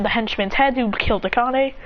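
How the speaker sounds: ordinary delivery, nothing marked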